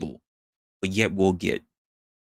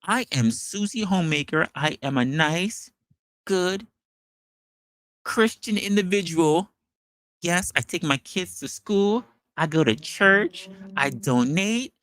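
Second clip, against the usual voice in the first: prim churchgoer act; 8 semitones higher than usual. Retold or imitated. Mocking the two-faced commenters as a saintly homemaker. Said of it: "this is what they are".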